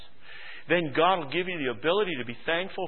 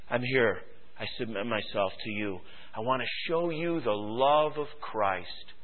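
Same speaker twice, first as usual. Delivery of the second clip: soft, low, sincere offer; humble submission; 6 semitones lower, 6 dB quieter than usual. Acted. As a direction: present yourself gently as a servant. Acted servant offering himself.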